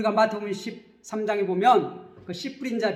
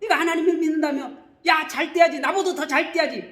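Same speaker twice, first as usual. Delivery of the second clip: high, raised jeering voice; acted accusing taunt; 6 semitones higher, 4 dB louder than usual. Accuser mocking a believer who has not prospered, scornful taunt. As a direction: challenge the believer scornfully, pitched up. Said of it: "참소하는 거예요".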